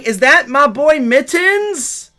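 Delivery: loud, high, drawn-out hail; excited greeting. Real, not acted. Streamer spots his boy Mittens, excited call. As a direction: call out happily, stretching the name. Hails a friend arriving in chat.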